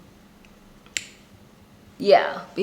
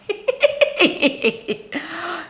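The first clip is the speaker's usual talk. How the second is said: giggling laugh; 9 semitones higher than usual. Real, not acted.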